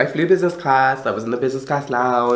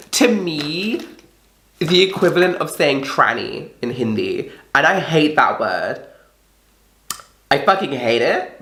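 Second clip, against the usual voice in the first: ordinary talk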